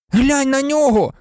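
high, loud angry yell; furious shout. A narrator's reading. Ron shouts furiously at Hermione over his rat. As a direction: shout it in her face. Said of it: "розлючено крикнув він Герміоні".

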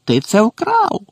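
high-pitched, triumphant accusation; gleeful accusation. A narrator's reading. Caretaker accusing the poltergeist of theft, gleefully. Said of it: "весело заревив Філч".

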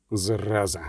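a low, soft grumbled swear; muttered curse. Acted. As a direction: spit the word out under breath. Witcher spitting, cursing.

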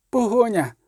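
high single-word alarm, lightly breathed out; exhaled warning. A narrator's reading. Girl warning the riders of pursuit, exhaled cry. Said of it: "видихнули".

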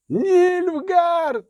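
very high, long-held shriek; piercing scream. A narrator's reading. Someone interrupts the dwarf with a warning, shrieking. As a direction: scream the alarm on one high sustained pitch. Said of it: "пронизливий вереск".

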